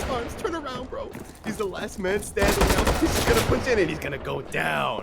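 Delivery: high, urgent caster's cry; excited play-by-play. Real, not acted. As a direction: call the climax with rising volume. Caster calling the round's climax.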